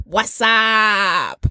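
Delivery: drawled horror movie "What's up"; Scream phone voice. Retold or imitated. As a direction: ghostface style drawn-out greeting.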